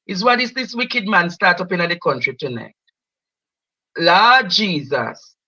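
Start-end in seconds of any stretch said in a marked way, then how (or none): none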